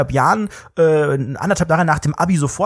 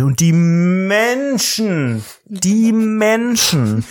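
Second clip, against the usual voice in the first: very slow, stretched words; slow, stretched pompous musing; drawn-out; 3 semitones higher, much slower than usual. Pretend. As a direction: savour each word like a pompous speaker. S0 role-play drawn out.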